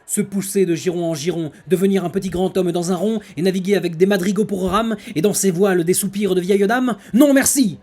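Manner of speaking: loud, high, grand theatrical declamation; hammy stage actor. Acted. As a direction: boom it out like a hammy tragedian. Declaims the non-merci tirade.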